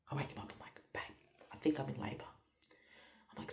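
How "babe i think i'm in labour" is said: very soft, quick imitated whisper; retold whisper. Wife waking husband to say labour started, acted whisper.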